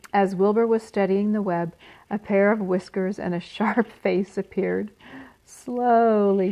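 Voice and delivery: ordinary delivery, nothing marked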